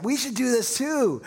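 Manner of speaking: high, drawn-out eager resolve; acting out a keen bright idea; excited. Ananias and Sapphira wanting to copy Barnabas, excited. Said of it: "they're so excited".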